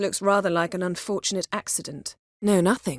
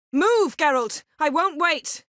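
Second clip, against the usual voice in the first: high, loud call to move; urgent battle cry; urgent shout; 9 semitones higher, 3 dB louder than usual. Acted. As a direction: snap him into motion.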